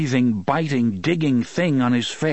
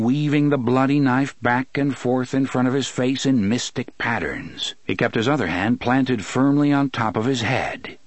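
ordinary delivery, nothing marked

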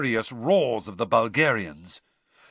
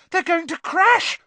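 very high, loud cry of alarm; shriek; 6 dB louder than usual. A narrator's reading. Hermione watching seekers hurtle to the ground, shrieking. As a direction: shriek a warning. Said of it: "shrieked Hermione".

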